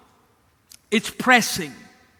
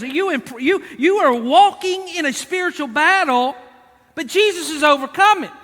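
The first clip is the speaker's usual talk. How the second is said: raised, higher preacher's voice; forceful preaching; 6 semitones higher, 5 dB louder than usual. Real, not acted. Preacher urging the congregation about spiritual battle, forceful preaching. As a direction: drive it home to the congregation.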